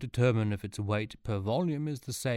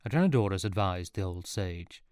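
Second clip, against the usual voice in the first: ordinary delivery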